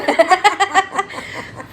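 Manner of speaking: high laugh. Real, not acted. Laughs at mom's question.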